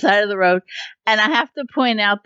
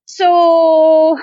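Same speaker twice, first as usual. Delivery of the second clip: drawn-out; 8 semitones higher, 5 dB louder than usual. Real, not acted. Drawn-out, loud transition word.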